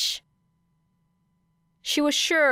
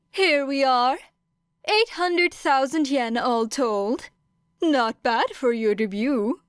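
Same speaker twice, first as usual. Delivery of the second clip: higher, pleased presenting voice; triumphant principal Nezu; 5 semitones higher than usual. Acted. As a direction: show off the envelope proudly. Nezu hands over the envelope.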